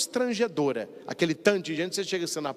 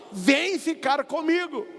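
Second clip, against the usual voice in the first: higher, slow, tender call; Jesus calling; 8 semitones higher than usual. Retold or imitated. Jesus calling his sheep by name, tender and slow. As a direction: invite gently like a shepherd calling his sheep. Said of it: "ele te chama pelo nome e fala".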